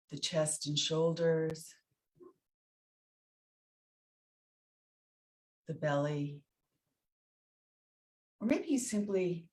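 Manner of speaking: soft, spacious meditation voice with long pauses; guided meditation. Real, not acted. Teacher guiding listeners through loving kindness practice, guided meditation. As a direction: guide them inward phrase by phrase, letting each one settle.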